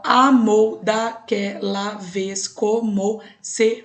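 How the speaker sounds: verse read very slowly, syllable by syllable; slow demo of counting syllables; slow scansion reading. Shown as a demonstration. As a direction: spell out the meter slowly. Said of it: "vamos lá, ó".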